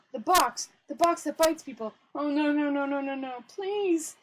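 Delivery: high, loud pleading in alarm; panicked. Acted. Guard recognising the box that bites people, panicked cry. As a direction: cry out in rising panic, ending in a plea.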